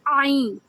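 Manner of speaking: "عین" squeezed hard from mid-throat; throaty Ain. Shown as a demonstration. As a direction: push "عین" out with force from the throat. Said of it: "سانس کی نالی کے درمیان سے زور". ("عین" حلق کے درمیان سے زور لگا کر)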